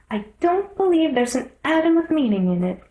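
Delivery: put-on a little girl scoffing; young girl voice. Alice scoffs.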